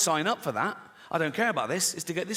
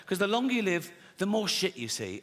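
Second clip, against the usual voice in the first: ordinary talk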